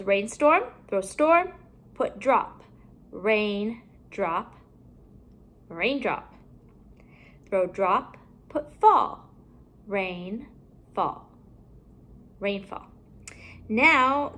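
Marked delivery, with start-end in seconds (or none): 3.1-4.5 two separate parts. Shown as a demonstration. Teacher building compound words for pupils, halves kept apart. 9.9-11.3 RAIN rising, a pause, then FALL lower; two separate parts. Shown as a demonstration. Teacher building compound words for pupils, halves kept apart.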